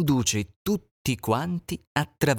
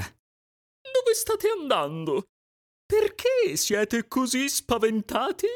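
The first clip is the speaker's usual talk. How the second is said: much higher, slow questioning goose; 13 semitones higher, slower than usual. Acted. Goose asking the frightened animals, slow higher voice.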